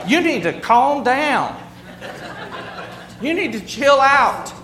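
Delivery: hammed-up impatient telling off; exasperated command. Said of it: "I would say".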